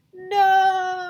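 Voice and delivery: high, loud cry of horror; horrified cry. Acted. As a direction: howl the no in despair. Horror at times table.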